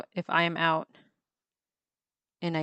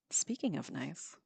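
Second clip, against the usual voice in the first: slow, breathy whispered aside; gasp, then whisper as if something caught your eye; 9 dB quieter than usual. Real, not acted. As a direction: gasp and whisper, distracted by the drawing. Suddenly noticing her drawing.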